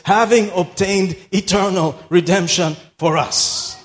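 slow, ringing scripture reading; preaching scripture. Real, not acted. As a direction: proclaim the verse with drawn-out phrases.

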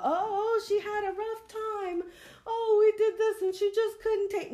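very high, mocking sing-song; mocking gossip voice. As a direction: mimic the fake sympathy of gossipers. Mocking what they will say.

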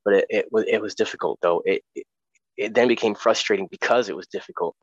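ordinary talk

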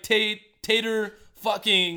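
slow drawn out. Shown as a demonstration. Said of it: "drawn-out infix demo".

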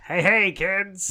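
high, loud, stretched-out call; hammed-up kids' greeting. Co-host clowning after the millennial joke, hammed-up greeting. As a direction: holler it like a children's entertainer.

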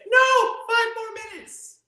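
put-on high, slow, loud child's whine; whiny kid. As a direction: beg like a child at bedtime. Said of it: "they say".

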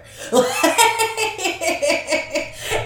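high, loud burst of laughter. Real, not acted. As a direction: laugh out at the joke. Laughing at killed each other.

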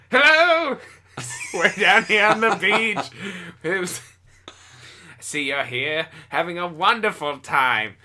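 put-on high, loud, laughing old-time reporter; forties reporter. Reporter greeting a beachgoer in a forties voice. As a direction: greet him like a hammed-up newsreel man. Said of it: "I'm trying to talk like a dude from the forties".

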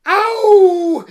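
high, loud shriek of pain; sudden high yelp; cry of pain. Acted. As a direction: scream it as the thorns bite. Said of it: "she cried".

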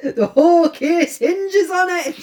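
loud, slow Scottish outburst; hammed-up anger in a Scottish accent; angry Scottish inspector. Inspector insisting on the truth, angry and Scottish. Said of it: "He's so angry".

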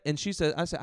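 normal talking voice

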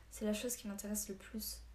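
ordinary delivery, nothing marked

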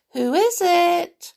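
very high, loud, slow princess call; sweet calling voice. A narrator's reading. Princess calls from inside the castle, sweetly. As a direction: sing out sweetly from afar. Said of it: "a sweet voice called".

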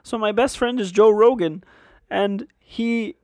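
high, loud, on the verge of crying; Jordan Peterson impression. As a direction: Peterson in earnest, voice about to crack. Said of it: "That was pretty good".

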